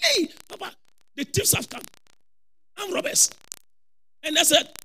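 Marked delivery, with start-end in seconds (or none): 0.0-3.3 high, hushed mock alarm; high-pitched retold warning; imitated alarmed wife. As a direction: act out a wife's urgent warning without raising volume. Voices wife warning of robbers.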